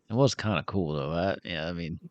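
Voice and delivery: low, soft confiding aside; hushed, sheepish confession. Acted. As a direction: drop your voice to admit it. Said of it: "he lowers his voice".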